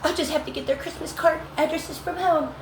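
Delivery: high mock whine of the upset coworker; mocking the crying lady. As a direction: put on her high tearful plea. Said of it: "And she's like".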